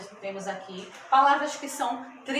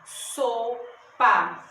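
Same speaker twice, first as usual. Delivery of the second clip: SO, pause, PA, each syllable separate; split into syllables; 4 dB louder than usual. Shown as a demonstration. Teacher showing two syllables in sopa, syllable by syllable. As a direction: sound out sopa in two drawn-out beats. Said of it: "eu abro minha boca duas vezes pra pronunciar".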